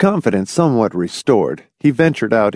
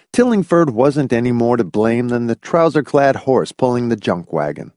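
plain reading voice

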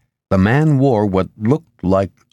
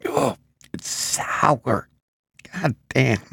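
drawn-out groan of disgust, softer; 6 semitones higher, 5 dB quieter, much slower than usual. A narrator's reading. Woman tasting spoiled milk, disgusted. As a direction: recoil from a sour taste, slowly. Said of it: "and made a face".

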